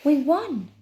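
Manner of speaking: high, loud triumphant announcement; announced. Acted. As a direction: proclaim victory to the tower. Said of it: "Nine announced to the tower".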